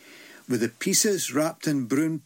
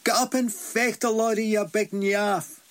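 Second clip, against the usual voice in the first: shouting; 7 semitones higher than usual. Acted. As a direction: yell at the fallen giant to get up. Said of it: "stayed shouting".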